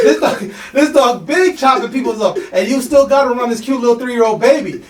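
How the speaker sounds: high, loud disbelieving rant with a laugh; incredulous outrage. Retold or imitated. Salesman inwardly ranting about the dangerous dog, loud and laughing. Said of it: "in my head, I'm like".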